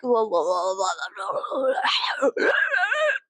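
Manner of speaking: nonsense babble rising into a wail; mock babbling wail. Mocking people pleasing the masses, babbling wail. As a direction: babble loosely, sliding up high.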